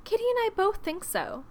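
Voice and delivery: high-pitched, excited insistence; excited young Lydia; exclaiming. A narrator's reading. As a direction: chime in eagerly, high. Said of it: "exclaimed Lydia".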